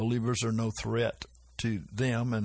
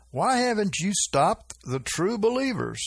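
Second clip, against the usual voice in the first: higher, upset demanding question; upset man; 8 semitones higher than usual. Retold or imitated. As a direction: play a rattled schemer confronting his partner. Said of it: "two men talking, both of 'em upset".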